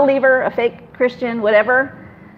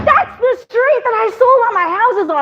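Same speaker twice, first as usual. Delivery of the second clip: high-pitched mock astonishment; amazed realtor; 10 semitones higher than usual. Realtor hearing her street name, amazed exclamation.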